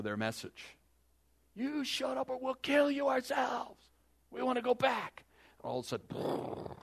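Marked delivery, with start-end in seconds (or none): 1.5-5.2 high, loud furious shout; shouting down the two men; angry mob shout. Acted. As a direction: yell it like an angry crowd ready to stone them. Angry crowd threatens Joshua and Caleb.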